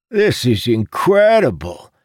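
high, loud burst of amazement; excited blurt. A narrator's reading. Jacob reacting to Amandal's change of ways, excited blurt. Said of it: "Jacob blurted".

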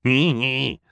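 high, wheezy mock laughter with a silly word; villain's laugh. A narrator's reading. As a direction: cackle out the nonsense word. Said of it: "Olaf cried in agreement".

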